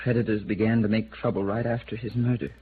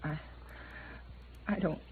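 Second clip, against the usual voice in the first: soft, higher, stammering plea; broken, frightened stammer; 5 semitones higher, 8 dB quieter than usual. Acted. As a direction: falter out the words, cornered and beaten. Cornered villain breaks down, pleading.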